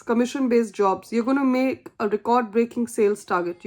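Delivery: ordinary talk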